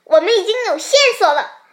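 high, loud, fast and excited; excited shout. A narrator's reading. The rabbit shows Xiaopang a clue, shouting excitedly. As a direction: shout a breathless discovery in a rush. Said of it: "兔子激动地大叫".

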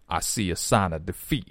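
"I" and "sign" opened wide to "ah" and "sahn"; exaggerated vowel for I. Shown as a demonstration. Teacher imitates the singer's vowels, "sahn" for "sign". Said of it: "这个I双元音发的会比较夸张".

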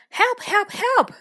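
high, loud, repeated cries for help; mock scream. Miss Doll's cake is on fire, screaming for help. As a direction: call out in urgent storybook panic. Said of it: "screamed Miss Doll".

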